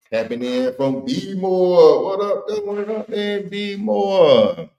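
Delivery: drawn-out, high singsong greeting; high, slow hometown holler without extra volume; high-pitched shout-out. Real, not acted. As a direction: greet the hometown in a soaring, stretched-out voice. Hyped greeting to Baltimore viewer.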